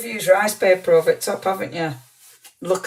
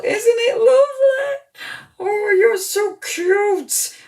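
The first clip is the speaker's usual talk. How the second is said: much higher, slow, squealing with delight over a gift; slower than usual. Real, not acted. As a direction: coo over it like a treasure. Gushing over a handmade gift.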